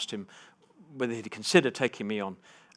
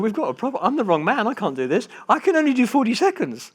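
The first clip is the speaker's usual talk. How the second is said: loud, high, panicky protest; mock panic; 12 semitones higher, 8 dB louder than usual. Pupil rings his tutor back to give up, mock panic. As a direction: blurt it out in exaggerated alarm.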